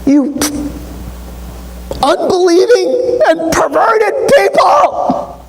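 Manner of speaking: hammed-up furious Jesus; exasperated shout. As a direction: blow up at them, sick of it. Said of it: "Does that sound kinda harsh".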